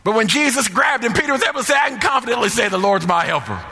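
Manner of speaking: high, sustained, shouted sermon delivery; full-force preaching. Real, not acted. Preacher rousing the congregation to confidence, fervent high-pitched preaching. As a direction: ring it out in rousing, driving phrases.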